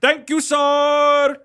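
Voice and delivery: high, loud, slow mock Punjabi accent; hammed-up Punjabi accent asking sirs for likes. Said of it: "master of that Punjabi accent".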